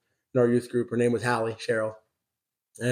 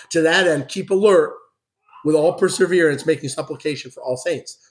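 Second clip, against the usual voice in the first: raised, louder preaching read; fervent Bible reading from the pulpit; scripture reading; 3 semitones higher, 4 dB louder than usual. Acted. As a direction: proclaim the passage to the church. Reads scripture aloud to congregation.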